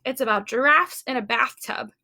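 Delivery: usual speaking voice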